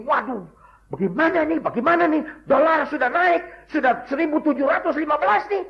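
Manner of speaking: high, fretful voice; mock fretting worrier. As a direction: play the panicked man over prices. Worried man about dollar rising.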